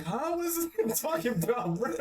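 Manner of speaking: high, baffled mock outrage; baffled disbelief. Viewer baffled by Demiurge's speech, high incredulous squeak. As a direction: squeak out total confusion at the nonsense. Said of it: "And I was just like".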